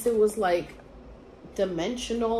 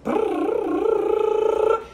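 fast high babbling trill; high silly trill for racing thoughts; rambling brrr; 12 semitones higher than usual. Retold or imitated. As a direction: mimic nonstop rambling with a rapid brrr. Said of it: "I was just rambling".

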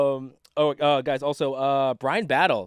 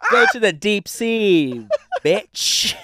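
laughing taunt; 5 semitones higher, 4 dB louder, much slower than usual. Real, not acted. Teasing friend, laughing.

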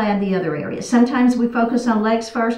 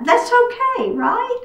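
lilting, much higher reassurance; high-pitched reassurance; 12 semitones higher, slower than usual. Real, not acted. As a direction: let them off the hook in a high voice. Reassures students who won't go deeper.

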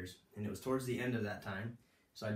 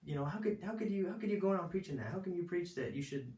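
high, put-on incredulous protest; mock how scandalized they were, pitched way up; imitated outraged disbelief; 10 semitones higher than usual. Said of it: "they couldn't believe that".

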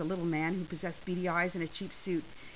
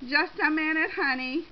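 raised, louder call to a companion; yelled; 7 semitones higher, 7 dB louder than usual. A narrator's reading. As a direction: call out to a friend to wait. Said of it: "Shirley yelled".

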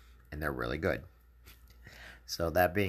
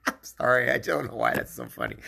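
giggling through the words; can't stop laughing at the joke; higher, louder, laughing apology; 6 semitones higher, 5 dB louder than usual. Real, not acted. Cracking up at own joke.

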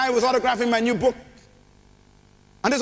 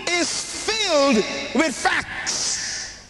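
higher, drawn-out shouted Hallelujah; preaching at full force; 7 semitones higher, slower than usual. Acted. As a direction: lift the room with a long shout. Preacher's closing proclamation.

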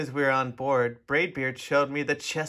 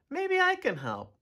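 put-on much higher eager child voice; small boy; 12 semitones higher than usual. A narrator's reading. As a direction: offer bravely like a little boy. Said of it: "I shouted over the".

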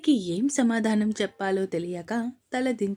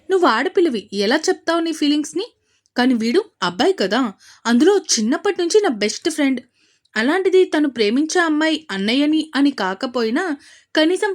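loud, higher, fast angry outburst; heated rant; 5 semitones higher, 6 dB louder, faster than usual. Acted. Advaith venting at his best friend for hiding the love affair, loud and heated. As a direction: let the grievance boil over.

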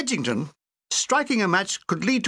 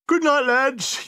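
yawning; 8 semitones higher, slower than usual. A narrator's reading. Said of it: "yawned Edgington".

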